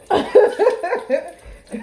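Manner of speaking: a bright high laugh after dancing; happy laugh. Real, not acted. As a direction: laugh out, pleased with yourself.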